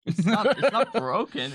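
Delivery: high, loud, laughing denial; laughing protest. Real, not acted. Host laughing, insisting the PDF isn't broken, laughing.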